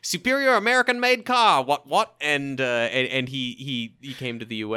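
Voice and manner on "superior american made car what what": lightly put-on British voice; a hint of an English accent. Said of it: "and he's like".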